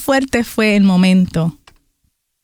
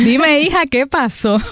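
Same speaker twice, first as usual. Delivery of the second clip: higher, amused replay of the pastor; a higher echo of the pastor's greeting; mimicking the pastor; 6 semitones higher, slower than usual. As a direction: replay her line higher, delighted. Repeats pastor's line amused.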